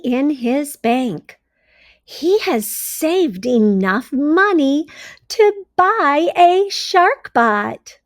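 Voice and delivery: bright, expressive storybook read-aloud; reading to children. A narrator's reading. Teacher reading a story to her class, expressive read-aloud. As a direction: read it like a teacher sharing a picture book.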